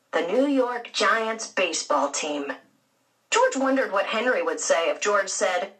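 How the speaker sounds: ordinary delivery, nothing marked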